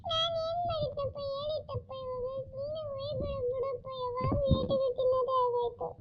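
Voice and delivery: put-on a scared kid pleading not to be hit; child crying out. Beaten child begging father to stop hitting, crying.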